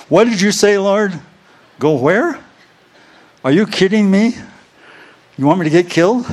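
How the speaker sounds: high, loud mock disbelief at God's orders; incredulous protest. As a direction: act out balking at a dangerous command. Retells arguing with the Lord.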